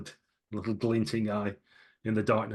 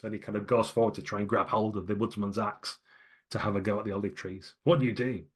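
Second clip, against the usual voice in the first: usual speaking voice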